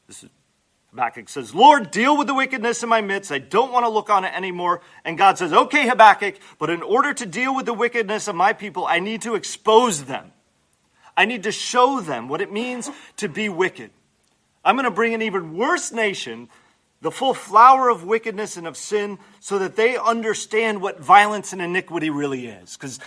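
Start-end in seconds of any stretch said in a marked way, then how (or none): 1.5-4.8 raised, urgent plea to the Lord; raised prophet's plea, faster at the end; pleading Habakkuk. Acted. 5.5-22.8 raised, firm declaration; a commanding voice of God; God answering. Acted. Preacher voices God's answer.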